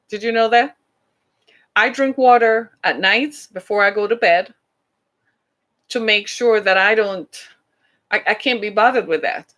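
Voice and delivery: normal talking voice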